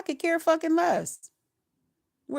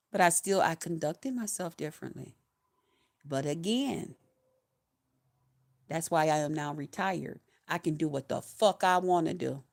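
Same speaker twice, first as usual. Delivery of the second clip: calm contented; 7 semitones lower, 6 dB quieter than usual. Real, not acted. Telling viewers retirement is beautiful, soft contented. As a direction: lower and softer, relaxed and content.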